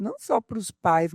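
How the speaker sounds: usual speaking voice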